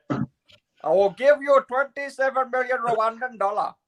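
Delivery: put-on slow, high, botched Welsh accent; a failed Australian-Welsh scam accent; bad Welsh accent. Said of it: "that was the worst Welsh accent I have ever heard".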